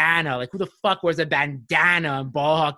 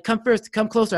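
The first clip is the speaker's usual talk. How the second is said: louder, higher goading dare; mock taunting; 7 semitones higher, 5 dB louder than usual. Winner daring the loser to come closer, taunting. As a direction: egg on a raging opponent. Teller taunts angry opponent.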